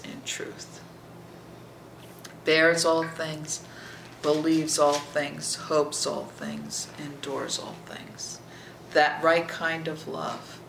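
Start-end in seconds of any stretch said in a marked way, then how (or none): 0.0-0.8 slow whisper; hushed, slow whisper. Real, not acted. Intimate scripture reading. 2.4-8.4 slow solemn recitation; slow solemn reading. Real, not acted. Measured scripture cadence.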